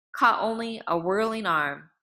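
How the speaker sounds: usual speaking voice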